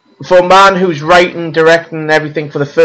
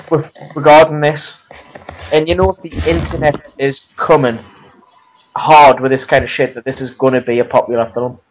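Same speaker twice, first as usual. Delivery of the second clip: normal talking voice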